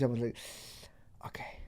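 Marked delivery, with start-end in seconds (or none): 1.3-1.6 whispered threat, low and breathy; menacing whisper. Retold or imitated.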